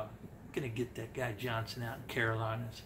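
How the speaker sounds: low, soft mock threat; minion. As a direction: imitate a henchman quietly plotting revenge. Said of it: "one of his minions watches this and they go".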